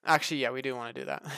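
usual speaking voice

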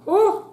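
sudden high "ooh" from spicy heat; burned-mouth squeal. Real, not acted. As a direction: yelp high as the hot food bites. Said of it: "Ooh, hot".

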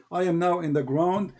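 usual speaking voice

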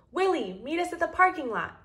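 understated read-aloud yell. A narrator's reading. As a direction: call out as if to someone far off. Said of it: "he yelled through the pipe".

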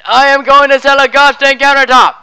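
loud determined outburst; shouting. Acted. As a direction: explode into a shout. Salesman explodes after menacing build.

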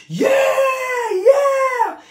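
a very high, loud, held cheering shout; crowd cheer. Retold or imitated. Cheering with twelve thousand people at the event, a high loud shout. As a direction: cry out like the roaring crowd.

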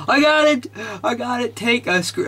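high-pitched burst of triumph; squealed, breathless celebration; excited shout. Real, not acted. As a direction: cry out in sudden excitement. Thinks the trick is working.

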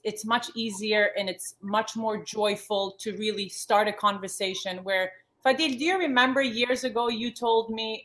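ordinary talk, nothing marked

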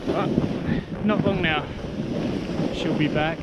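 ordinary talk, nothing marked

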